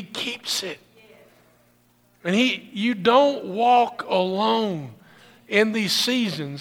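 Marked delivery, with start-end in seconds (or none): 2.2-6.6 slow, deliberate "you don't walk alone"; emphatic. Shown as a demonstration. Pastor assuring the congregation, slow and emphatic. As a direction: press each word home slowly. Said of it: "That's what I want you to hear".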